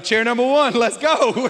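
loud, high hype call to the newcomer; excited welcome. Retold or imitated. Pastor hyping up a first-time visitor, loud and high. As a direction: cheer them in like a hype man.

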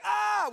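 high, loud mock panic cry; freak-out yell. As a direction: yelp like the frightened servant. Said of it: "we act like the servant".

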